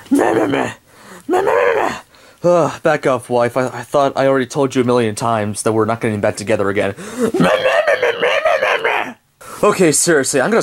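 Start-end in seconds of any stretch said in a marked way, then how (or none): 0.1-2.0 whiny bleating. Acted. Ex-wife whining at him. 7.0-9.1 high mock whimpering mehs; whiny bleating. Ex-wife whining again.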